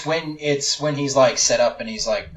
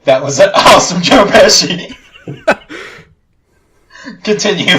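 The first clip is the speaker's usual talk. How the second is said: louder, laughing through the words; cracking up at the impression; 6 semitones higher, 8 dB louder than usual. Real, not acted. Friend laughs at the Joe Pesci impression.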